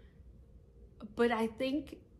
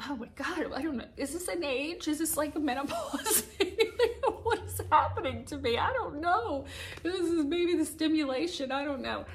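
real emotion welling up; 7 semitones higher, 4 dB louder than usual. Real, not acted. Vlogger to her viewers, overwhelmed with grateful tears. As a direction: voice pitched higher, fighting back real tears. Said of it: "I don't know why I'm so emotional".

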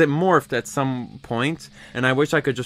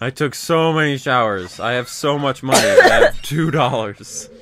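louder, drawn-out mocking echo; exaggerated boast breaking into a laugh; mock bragging; 3 semitones higher, 8 dB louder, slower than usual. Host teasing friend over so many showers, mock bragging.

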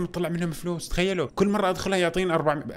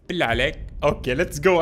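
ordinary talk

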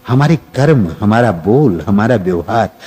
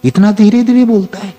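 a hint of mocking, rising much higher; mock scoffing; 6 semitones higher than usual. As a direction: lightly voice a sceptic scoffing. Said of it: "कई लोग सोच सकते हैं".

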